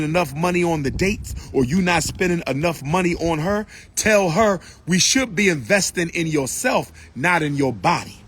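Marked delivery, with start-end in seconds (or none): none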